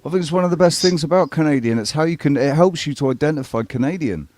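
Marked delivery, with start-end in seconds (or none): none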